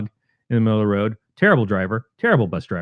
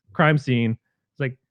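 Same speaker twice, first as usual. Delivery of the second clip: ordinary talk, nothing marked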